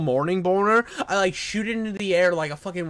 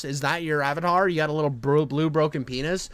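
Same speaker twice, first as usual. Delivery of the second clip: ordinary talk, nothing marked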